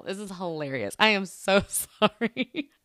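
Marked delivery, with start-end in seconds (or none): 1.0-2.7 slow, louder apology breaking into laughter; amused, drawn-out apology; laughing apology. Real, not acted. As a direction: apologize while cracking up. Laughing apology for her bad accent.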